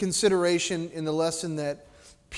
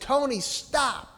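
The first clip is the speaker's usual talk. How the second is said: high, drawn-out mock call; mother's command; 7 semitones higher, slower than usual. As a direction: call out a sudden warning, stretched out. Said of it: "my mother said".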